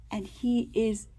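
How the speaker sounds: ordinary delivery, nothing marked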